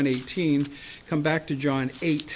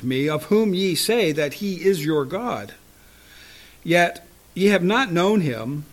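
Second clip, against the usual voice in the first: louder emphatic King James reading; preached scripture reading; 5 dB louder than usual. Acted. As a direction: proclaim the verses.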